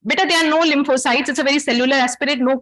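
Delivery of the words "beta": ordinary delivery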